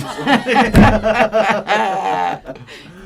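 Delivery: high burst of laughter; loud laugh. Real, not acted. Laughing at whistling S.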